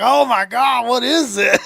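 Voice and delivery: high, loud mock panic; mock freaking out. Host mimicking Texans hit by cold rain, mock freak-out. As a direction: imitate locals in a hammed-up panic. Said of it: "They're freaking out, like".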